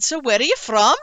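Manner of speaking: a hint of an elderly Welsh taxi driver; lightly imitated old man's lilting question; little old Welsh man. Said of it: "the little man".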